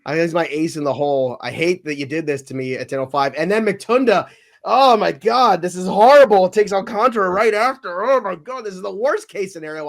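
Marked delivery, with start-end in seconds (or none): 3.4-9.9 higher and louder, rising in dismay; real exasperated wail over the draft; anguished outcry. Real, not acted. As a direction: cry out as the picks get taken. Rivals snipe his picks.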